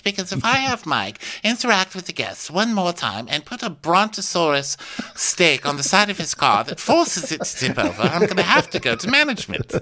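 high, fast put-on complaint; Faye impression voice. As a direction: rant quickly up high as Faye. Faye's side of acted quarrel.

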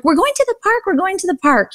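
very high, sing-song coaxing; high coaxing mom. Retold or imitated. Mom coaxes her screaming son toward the park, high and singsong. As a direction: chirp it up to cheer a crying toddler. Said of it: "and I'm like".

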